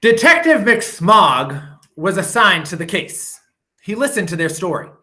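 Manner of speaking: ordinary delivery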